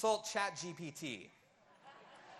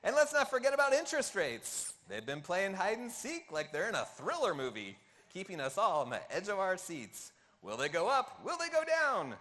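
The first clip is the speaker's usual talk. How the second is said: put-on Seinfeld accent; 6 dB louder than usual. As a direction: sell it like an over-the-top stand-up bit. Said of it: "read this in a Seinfeld accent".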